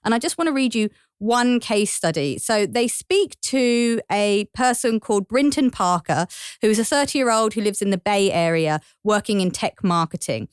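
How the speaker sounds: normal talking voice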